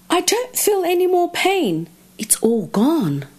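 high-pitched delighted relief, dropping at each end; amazed relief. Acted. Crocodile sits up free of toothache, high amazed relief. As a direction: exclaim it high, as if the pain just vanished. Crocodile finds his pain gone.

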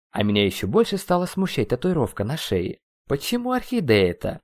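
ordinary delivery, nothing marked

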